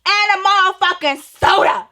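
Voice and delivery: high, loud, slow, every word dragged out; mock-polite high yell. Teen shouting her order at the rude cashier, high, loud and drawn out. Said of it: "Let me take it up three or four octaves".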